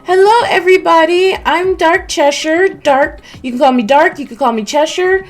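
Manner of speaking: squeaky put-on high voice; high fake voice. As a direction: pitch it way up, cutesy. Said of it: "Let me get that fake voice out of the way because I can't talk that high".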